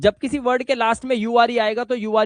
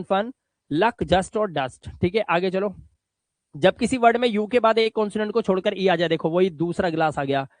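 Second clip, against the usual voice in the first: ordinary delivery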